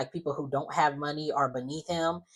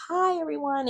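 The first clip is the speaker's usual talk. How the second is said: sweet greeting; 13 semitones higher than usual. Retold or imitated. Newcomer greeting the group, high sing-out imitation. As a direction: imitate a friendly greeting, pitched way up.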